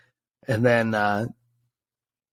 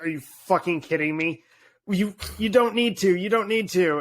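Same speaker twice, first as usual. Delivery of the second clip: welling up; 6 semitones higher than usual. Retold or imitated. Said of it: "I started to well up".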